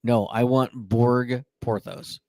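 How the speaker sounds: ordinary delivery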